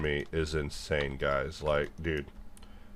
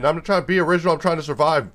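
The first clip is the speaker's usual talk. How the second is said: much higher and louder, yelling the retort; exasperated shout; 11 semitones higher, 8 dB louder, much faster than usual. Retold or imitated. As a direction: fire back at them at full volume. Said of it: "I'm like".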